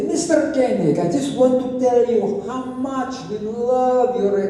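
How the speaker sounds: lightly mocked fan gush; gushing young fans. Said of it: "two young women come up to me".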